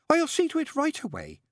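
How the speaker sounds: high, bright-voiced chattering nun; fussy nun. A narrator's reading. Nun greeting the father in a high voice. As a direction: pitch it high like a flustered nun. Narrator voices Sister Mary.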